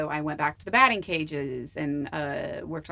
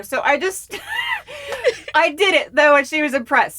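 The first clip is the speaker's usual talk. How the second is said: laughing; 11 semitones higher, 10 dB louder than usual. Real, not acted. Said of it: "never said kayak in an Irish accent".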